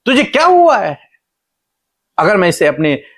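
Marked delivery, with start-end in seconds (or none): 0.1-1.0 very high, emphatic questions; God questioning Hagar. Retold or imitated. God asks Hagar why she cries, high emphatic questioning. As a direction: pitch each question way up, then drop at the end. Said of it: "परमेश्वर पहली बात उससे कहते हैं".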